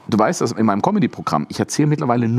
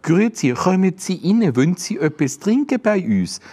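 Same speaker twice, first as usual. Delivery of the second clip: German faking Swiss German, higher and slow; hammed-up tourist-trap Swiss German, slow; fake Swiss German; 7 semitones higher than usual. German host putting on Swiss German for guests, hammed-up and slow. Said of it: "Niederdorf-Oper".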